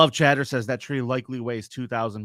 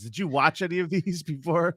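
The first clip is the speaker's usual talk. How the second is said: ordinary talk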